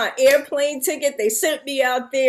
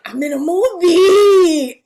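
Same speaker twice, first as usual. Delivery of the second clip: dazed excitement; 4 semitones higher, 5 dB louder, slower than usual. Retold or imitated. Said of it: "just like this".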